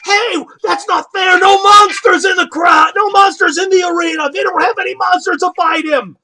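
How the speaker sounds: high, loud indignant shouting; crowd protest. Acted. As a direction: yell the complaint from the stands. Angry spectators protesting.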